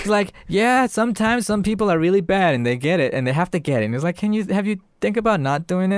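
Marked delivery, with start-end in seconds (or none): 0.5-3.9 high-pitched doll justifying himself; explaining killer doll. Retold or imitated. Chucky justifying murder to little girl, explaining. 4.2-6.0 put-on high, tentative child's request; pleading little girl. Little sister talking Chucky out of it, pleading.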